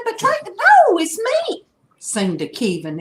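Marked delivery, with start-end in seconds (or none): 0.0-1.6 squeaky-high mock protest; high-pitched protest. Protesting to Tracy in a retold story, high-pitched.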